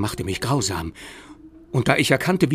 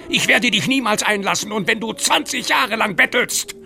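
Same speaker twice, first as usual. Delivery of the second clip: higher, loud terrified yell; shouting; 10 semitones higher, 6 dB louder than usual. Acted. Lockwood screams at the ghost child in terror, shouting. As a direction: scream it at the thing outside. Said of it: "schrie ich".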